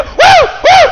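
put-on very high, loud girlish whoops; little girl's whoops. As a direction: imitate a girl shouting for joy. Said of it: "She go".

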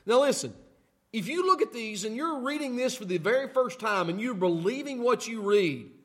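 normal talking voice

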